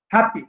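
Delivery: "Happy" with हैप strong, पी falling; stress on first syllable. Shown as a demonstration. Teacher giving an adjective example, first syllable stressed. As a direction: lean on the first half of "happy". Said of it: "पहला syllable जो stress होगा".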